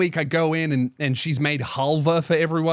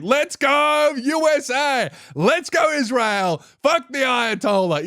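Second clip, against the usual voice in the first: higher, slow chant-like cheer; rally cheer; 8 semitones higher, slower than usual. Retold or imitated. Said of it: "every Iranian person I talk to is like".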